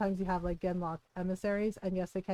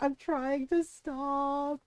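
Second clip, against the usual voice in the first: slow, higher mock-distressed plea; imitating whining Heron; 4 semitones higher, much slower than usual. Streamer voicing poor Heron who tries so hard, mock distress. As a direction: drag it out in a hammed-up wail. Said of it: "Heron is like".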